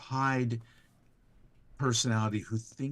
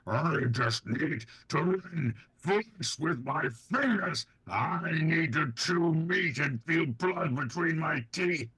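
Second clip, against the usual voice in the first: super mutant. Acted. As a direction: drag the words out like a beast losing control. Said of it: "your Superman voice is amazing".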